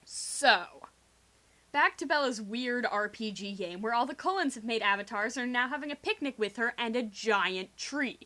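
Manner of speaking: usual speaking voice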